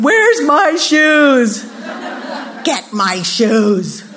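slow, drawn-out bratty demand; mock child's yell; demanding kid. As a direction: act a bossy child, stretching each word. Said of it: "when our kids wake up".

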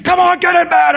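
hammed-up frustrated shout; mock yell. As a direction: bark at the kids to get to bed. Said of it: "raised my voice".

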